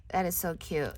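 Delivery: usual speaking voice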